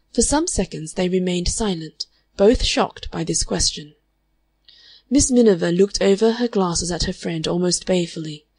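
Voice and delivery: usual speaking voice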